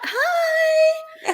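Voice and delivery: a high, loud, delighted "Hi" with a laugh; excited greeting. Real, not acted. Host greets a friend in the chat, squealing with delight. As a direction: squeal hello at a friend who just showed up.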